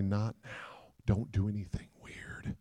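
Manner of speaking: urgent under-the-table hush; hushed warning. Retold or imitated. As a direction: warn him under your breath.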